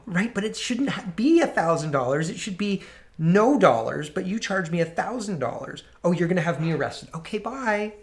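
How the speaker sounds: fake-polite protest that folds into a chirpy bye; mock meek complainer. Mock complainant continues, then arrested.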